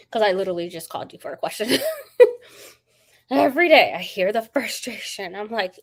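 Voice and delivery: ordinary talk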